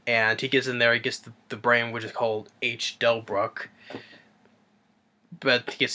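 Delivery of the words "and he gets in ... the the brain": usual speaking voice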